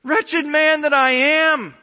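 loud, high, long-held despairing cry; preacher acting out anguish at full voice. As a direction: wail it slowly, like an anguished confession. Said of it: "this cry of despair".

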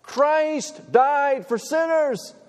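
drawn-out, high ringing preacher line, slow; preacher's proclamation. Retold or imitated. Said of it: "from a preacher like right now".

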